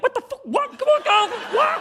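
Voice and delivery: hammed-up "are you kidding me"; mock indignant cry of shock; outraged disbelief. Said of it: "You'd be like".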